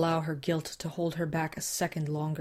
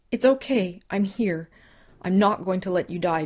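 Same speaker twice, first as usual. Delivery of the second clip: urgent comfort; 3 semitones higher, 6 dB louder than usual. Acted. Susan begging dying Jim to hold on, urgent loud comfort. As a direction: push the comfort out over panic.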